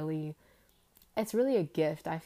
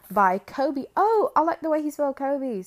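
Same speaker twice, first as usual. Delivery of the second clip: loud, higher-pitched burst of delight; delighted discovery; 7 semitones higher, 11 dB louder than usual. Real, not acted. Podcaster spotting the author's name on a book, loud delight. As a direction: exclaim it, pleasantly struck.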